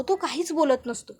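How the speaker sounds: usual speaking voice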